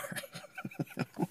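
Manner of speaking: soft breathy chuckle; real laugh. Real, not acted. Amused after voicing Gideon pushed too far, soft laughing. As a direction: quietly crack up after the bit.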